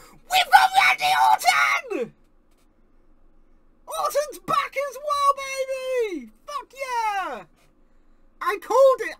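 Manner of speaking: loud high screaming with joy; ecstatic shout. Real, not acted. Fan screaming as his favourite returns, ecstatic shout. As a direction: scream it with total delight. Live reaction to Orton return.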